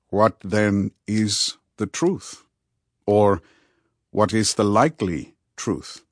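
lightly voiced counselor, deliberate pauses; measured, Socratic questioning; Rudy, probing and patient. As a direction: lead a friend through it step by step.

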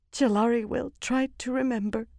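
high, timid reply; meek character voice. A narrator's reading. Meek woman answers her minder.

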